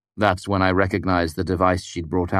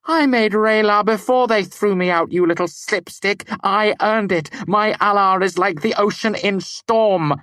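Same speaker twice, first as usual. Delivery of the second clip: much higher, louder raging tirade; 5 dB louder than usual. A narrator's reading. Devi raging at Kvothe while fighting his binding, furious. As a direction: rage at him, boasting her power. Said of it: "her face a mask of rage".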